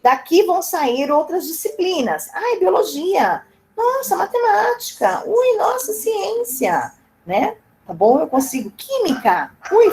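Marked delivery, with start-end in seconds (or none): 2.3-6.9 much higher, gushing over each subject; mock excitement. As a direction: play a thrilled student gushing over school subjects. Mock students thrilled by subjects. (empolgação fingida, bem aguda)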